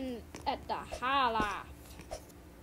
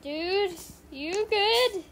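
loud, high-pitched check-in; calling out; 7 semitones higher, 8 dB louder, much slower than usual. Real, not acted. Gamer checking on another player, loud concerned call.

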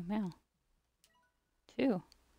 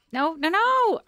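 much higher and louder rapid no's; alarmed cry; 12 semitones higher, 9 dB louder than usual. Real, not acted. Gamer watching a slime escape, high loud protest. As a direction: panic as it slips away.

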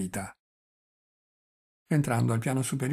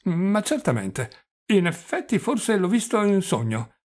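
put-on high, loud old man's voice; doddering old prince, musing; 6 semitones higher, 5 dB louder than usual. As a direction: recall a dream with muddled conviction. (vecchio principe svanito, voce alta e acuta)

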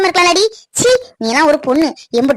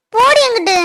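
tearful; 4 semitones higher than usual. Acted. Girl snaps back in tears.

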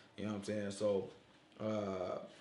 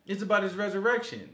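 ordinary delivery

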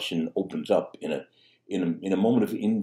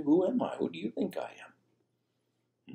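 Jesus' searching question; 11 semitones higher, 3 dB quieter, slower than usual. Retold or imitated. Jesus voiced asking Pilate.

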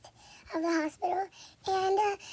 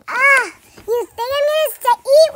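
much louder, higher scornful yell; mock outraged shout; 6 semitones higher, 12 dB louder than usual. Pretend mother storming away from the crying baby, shouting. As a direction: throw it back as you storm out.

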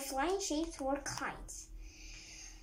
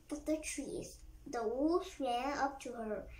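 ordinary delivery, nothing marked

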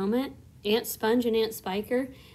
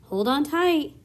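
loud, higher warning call; urgent shout; 6 semitones higher, 7 dB louder than usual. A narrator's reading. Grasshopper warns everyone as the peach heaves, loud urgent call. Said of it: "shouted the old green grasshopper".

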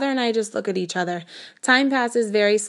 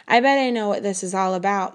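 plain reading voice

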